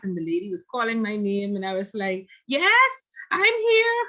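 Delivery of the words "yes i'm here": high, loud, drawn-out answer to roll call; retold frantic yell. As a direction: holler back as if from another room.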